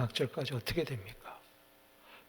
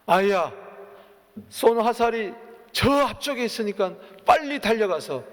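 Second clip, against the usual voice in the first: much louder, higher shout; 8 semitones higher, 8 dB louder than usual. Retold or imitated. Jonathan shouts the signal to the boy, loud shout. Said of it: "크게 외치는 거예요".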